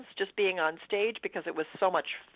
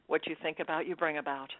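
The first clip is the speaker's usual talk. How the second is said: quiet self-reassurance; 2 semitones lower, 9 dB quieter than usual. Retold or imitated. Actor steadies herself before first audition, quiet self-reassurance. Telling herself off bad thoughts.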